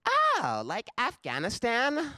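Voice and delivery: put-on high, drawn-out ditzy girl voice; clueless chick. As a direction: mimic her dim realization, pitch leaping way up. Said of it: "And then she goes".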